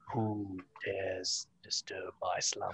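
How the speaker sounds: slow, low booming cave voice; grinding stone statue. Retold or imitated. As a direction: rumble it like an ancient stone guardian. Cave of Wonders line, movie riff.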